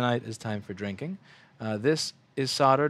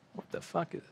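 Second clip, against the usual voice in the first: 1 startled; 6 semitones higher, 10 dB quieter than usual. Real, not acted. Startled by someone at his stuff.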